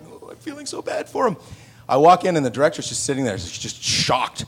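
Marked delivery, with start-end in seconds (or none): 0.1-1.4 mock pity. Pitying the bad actor.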